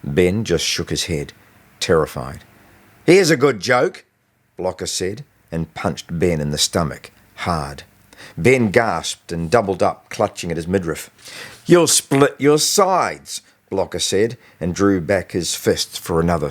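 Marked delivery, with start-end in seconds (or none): 3.0-4.0 louder, higher mocking bully; a touch of a cruel school bully; bully taunt. A narrator's reading. Bully about to punch Ben. 11.7-13.4 slow, higher, louder cruel taunt; a voiced bully, gloating and slow; mocking bully. A narrator's reading. Bully mocks Ben after punching.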